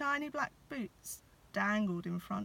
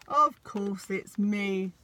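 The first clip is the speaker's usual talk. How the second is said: louder, slow Santa growl; growly Santa; 8 dB louder, slower than usual. A narrator's reading. Santa answering Molly from the chimney, slow and loud. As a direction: rumble it out, a bit offended. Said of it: "answered the growly voice".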